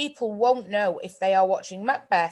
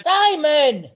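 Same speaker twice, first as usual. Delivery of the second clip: loud, higher call of a name; 7 semitones higher, 7 dB louder than usual. Real, not acted. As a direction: call Simon sharply. Calls out to a castmate.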